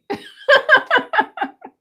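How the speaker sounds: loud, high cackling laugh. Real, not acted. Host after faking a goodbye to viewers, big high laugh. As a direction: burst out laughing at your own prank.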